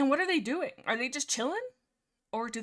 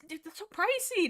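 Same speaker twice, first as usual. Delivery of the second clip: shocked; 9 semitones higher than usual. Real, not acted. Shocked at dress adjustment price.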